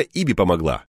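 ordinary delivery, nothing marked

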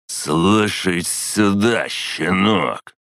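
high, slow threatening snarl; drunk menace. A narrator's reading.

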